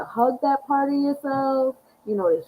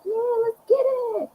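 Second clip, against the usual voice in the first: much higher, pumped-up answer; hyped reply; 14 semitones higher than usual. Retold or imitated. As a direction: fire back at the planets, all hyped. Her reply to the planets.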